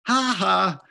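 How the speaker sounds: high, loud mocking sneer; taunting bully. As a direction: jeer at her like a schoolyard bully. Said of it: "taunted Natalie Gooch".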